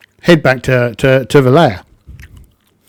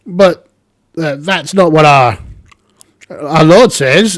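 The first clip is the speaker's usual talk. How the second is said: higher, louder goblin objection; 5 semitones higher, 5 dB louder than usual. Acted. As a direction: protest like a goblin.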